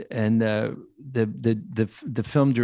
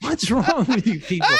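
high, laughing outrage; young actor hearing a gross warning, appalled; appalled disbelief; 11 semitones higher than usual. Retold or imitated. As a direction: squeal it in shocked disgust. Appalled at stunt guys' warning.

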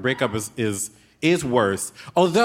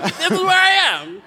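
mock chirpy announcement; 5 dB louder than usual. Pretending to send a location.

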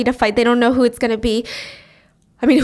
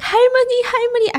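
high, louder imitated wail; crying out; 11 semitones higher, 3 dB louder, much faster than usual. Mimo cries for her grandma, imitated crying out. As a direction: wail the name twice in mock grief. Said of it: "as she's crying".

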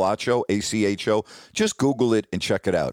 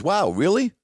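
higher-pitched mock surprise; retold surprise; 8 semitones higher than usual. Gal reacting to his sportscaster job, surprised. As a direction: imitate her impressed reaction.

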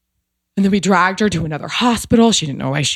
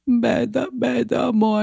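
higher, tearful-sounding Italian mama calling softly; imitating Italian mother-in-law; 7 semitones higher, 3 dB quieter than usual. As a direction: voice the teary mother-in-law, pitched up. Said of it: "just stares at me and goes".

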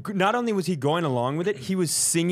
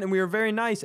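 ordinary talk

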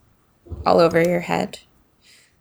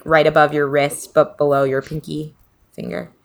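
ordinary talk

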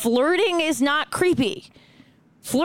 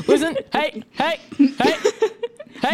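catcalling; 4 semitones higher than usual. Acted. Mock catcalling a stranger.

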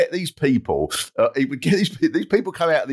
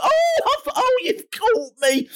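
much higher, hammed-up wail of dismay; caught-out contestant. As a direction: whimper as if just caught out, slowing down. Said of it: "and she'd go".

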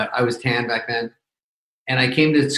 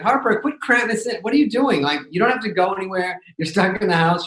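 exasperated dad; 6 semitones higher than usual. Retold or imitated. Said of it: "I'm like".

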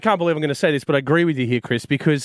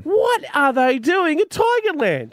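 incredulous; 10 semitones higher, slower than usual. Real, not acted. Incredulous outburst.

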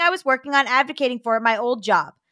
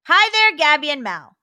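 bright raised read-aloud voice; letter reading; 3 semitones higher, 5 dB louder, slower than usual. Acted. Host starting to read a listener letter, bright.

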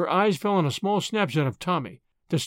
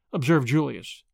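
ordinary delivery, nothing marked

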